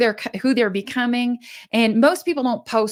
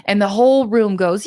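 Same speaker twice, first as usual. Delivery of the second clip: normal talking voice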